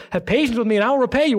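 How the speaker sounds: a hint of a desperate slave; mock plea. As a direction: hurry the begging line. Fellow slave's plea, higher, faster.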